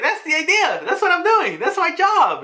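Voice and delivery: high-pitched, excited cartoon-hero cry; excited taunt. Luffy answers Kizaru mid-fight, excited taunt. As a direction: crow it high, relishing the fight.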